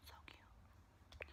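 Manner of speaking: soft conspiratorial whisper; whispered aside. Real, not acted. Admitting to viewers the tan is a lie, whispered. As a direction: whisper the truth like a secret.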